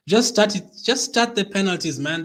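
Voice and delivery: high, loud, anxious urging; nervous impatience. Real, not acted. Anxious fan urging the penalties to begin, nervous impatience. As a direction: urge them on, high-pitched and panicky. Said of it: "making me even more scared".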